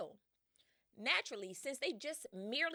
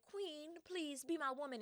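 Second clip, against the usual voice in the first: higher, softer, drawn-out plea; mock pleading suitor; 6 semitones higher, 5 dB quieter than usual. Suitors beg the queen for her hand, mock pleading. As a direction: imitate a lovesick suitor begging slowly.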